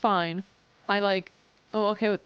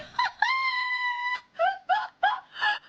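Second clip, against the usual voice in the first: shrieking laugh, far higher; high squealing laugh. Real, not acted. Streamer laughs at viewer chat, high squeal.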